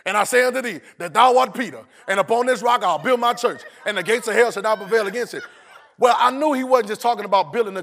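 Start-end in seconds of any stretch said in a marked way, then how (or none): 0.1-5.4 high, loud recitation without stopping; rushed reading. Shown as a demonstration. Preacher imitating how congregation reads scripture, loud rushed recitation. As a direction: charge through it like a familiar verse. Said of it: "We read it like this".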